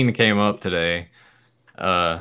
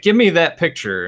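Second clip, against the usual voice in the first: higher, eager retold command; eager demand; 8 semitones higher, 4 dB louder than usual. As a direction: pitch it up like a plotting prankster. Retells her scheming demand.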